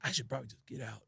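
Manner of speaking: very soft, low aside to yourself; hushed inner voice, retold; muttered thought. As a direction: think it aloud under your breath. Private thought during the show.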